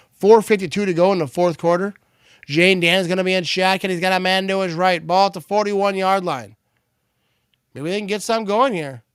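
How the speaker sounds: live play-by-play. Real, not acted. Streamer setting up a fourth-quarter snap, live play-by-play. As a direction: call the snap as it happens.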